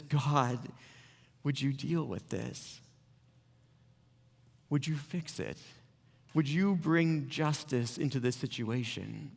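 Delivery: soft, slow pleading prayer; a quiet plea to God; hushed prayer. Acted. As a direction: pray it quietly, asking God for help. Prayer voiced within teaching.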